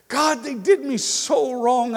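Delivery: loud, slow, aching plea to God; pained prayer cry. Shown as a demonstration. Pastor crying out to God in prayer, loud and pained.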